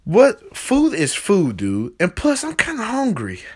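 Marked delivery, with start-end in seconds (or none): none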